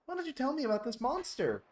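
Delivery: normal voice. Acted. Player mimicking the dolphin before the accent, normal voice. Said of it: "like, normal voice".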